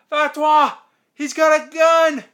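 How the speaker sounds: high, very loud alarm yell; imitated scream. Retelling a man bursting into the funeral, screamed alarm. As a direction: yell it out like a panicked warning. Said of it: "So he screams".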